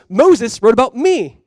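loud, high, drawn-out declaration; emphatic quote. Retold or imitated. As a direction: proclaim it slowly and forcefully. Quotes Jesus making his claim.

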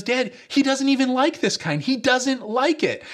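put-on high, tattling little girl voice; mock sister tattling, pitched high. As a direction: tell on her brother to dad. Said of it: "She goes".

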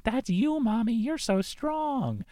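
put-on high, slow little-girl voice; a toddler admiring her mother, high and slow; child voice. A small child praising her mom's strength, high little voice.

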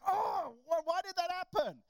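high, loud frustrated whine. Retold or imitated. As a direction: whine as if cut off right at the worst moment. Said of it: "and it's like".

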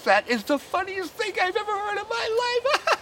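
high, squealing lady voice, way up; mock woman laughing. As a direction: play the giggling lady, high-pitched. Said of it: "The woman goes like this".

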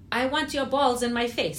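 put-on quoting the aunt with a Brazilian Portuguese accent, laughing; half-laughing Portuguese accent; mock accent. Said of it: "but with her accent".

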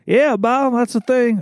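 high-pitched bad Mississippi drawl; awful Mississippi accent. Customer calling about pants, bad Mississippi accent. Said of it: "This is an awful Mississippi accent".

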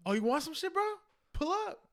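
very high, aggressive bro challenge; frat bro. Retold or imitated. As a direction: pick a fight, rising up. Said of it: "he'll be the one fucking fighting".

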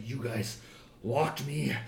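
low, quiet, seething anger; very angry. Acted. Game master as the boss angrily confronting the players, low and quiet. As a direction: keep the fury low and quiet. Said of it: "very angrily and is like".

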